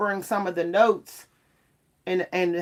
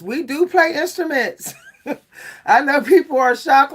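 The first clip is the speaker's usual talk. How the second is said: laughing through the words, pitched up; 6 semitones higher, 4 dB louder than usual. Real, not acted. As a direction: chuckle as you insist.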